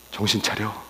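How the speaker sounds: low, hushed reproof; softly voiced scolding; acted rebuke. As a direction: drop to a quiet, stern admonishment. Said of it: "저한테 책망하세요".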